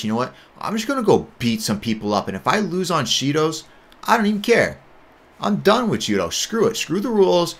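high-pitched fed-up rant, climbing as it goes; retold angry defiance. Athlete telling his coach he's done with the rules, angry and defiant. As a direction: build into a worked-up rant, voice rising high. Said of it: "going through camp just, like, angry".

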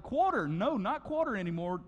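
ordinary delivery

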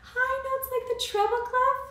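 high notes sung up high. Shown as a demonstration. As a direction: float it up high like a treble note. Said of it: "do you think an elephant makes high notes".

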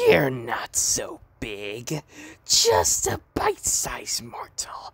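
Nightmare BB voice. Retold or imitated. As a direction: taunt like a twisted creepy doll. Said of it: "Anyways, let's do it".

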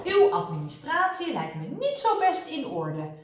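high, emphatic official disapproval; stern reprimand. A narrator's reading. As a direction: find fault like a pompous inspector. Inspector scolds flustered nurse. (streng berispend)

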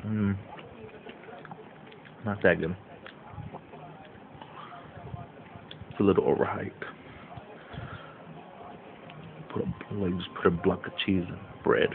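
chewing while talking; talking between bites; mouth full. Real, not acted. Seen 2: Bit snack at -2, lips closed chewing, snack held by mouth.

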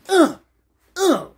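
high, short loud grunts; acted impact grunts. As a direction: grunt with each imagined blow. Imitates being kneed.

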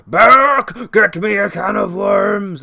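loud, slow, strained monstrous bellow with a cry in it; monster master's voice. Retold or imitated. Master upstairs orders Burke to fetch worms, loud strained monster bellow. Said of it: "I can't really do an impression of the voice".

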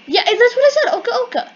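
high, loud exasperated protest; loud insistence. Real, not acted. Player defending a disputed pronunciation, high loud insistence. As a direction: insist at the top of your voice.

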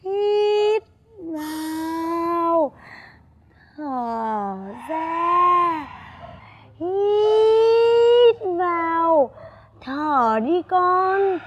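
very slow, drawn-out breathing cues; breathing demo. Shown as a demonstration. A mother showing her son how to breathe, very slowly. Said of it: "Tập như mẹ nào".

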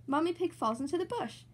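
plain reading voice

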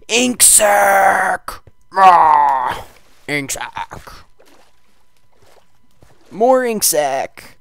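loud high triumphant cries; loud gleeful loot cheer; excited cheer. Real, not acted. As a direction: whoop over the loot. Celebrating loot.

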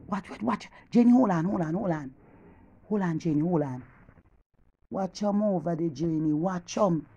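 very soft, low, urgent aside; hushed spying. Acted. Woman telling Jenny to hold on while watching the neighbour, hushed. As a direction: drop right down so he can't hear.

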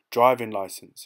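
loud, curt order; snapped. A narrator's reading. Policeman demanding the driver's papers, loud snap. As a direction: snap it out as a command. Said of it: "he snapped".

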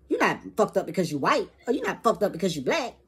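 normal talking voice

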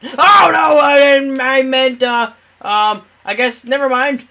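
high panicked cry; panicked shout. Real, not acted. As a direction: panic as danger hits. Panics when attacked in game.

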